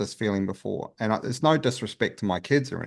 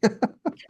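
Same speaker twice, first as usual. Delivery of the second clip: laugh. Real, not acted. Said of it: "I'll just be like Kelly".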